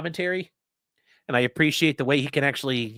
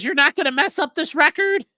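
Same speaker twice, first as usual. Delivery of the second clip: high, louder imitated objection; mock indignant protest; 11 semitones higher, 5 dB louder than usual. Streamer acting out counsel protecting the record, mock indignant protest. Acted quarrel over the record.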